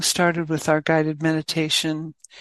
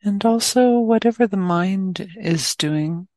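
guided meditation. Acted. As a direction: guide listeners gently into awareness, leaving plenty of space.

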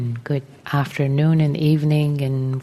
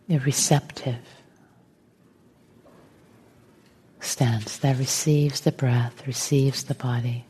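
guided meditation; 3 dB quieter than usual. Real, not acted. Teacher settling the group into meditation, calm and unhurried. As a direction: gently guide them into stillness, phrase by phrase.

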